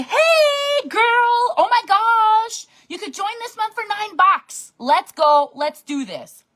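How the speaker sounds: high, bright excited sales pitch; gushing recruiter pitch. Retold or imitated. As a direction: gush like a friendly recruiter sliding into DMs. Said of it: "I'm gonna be".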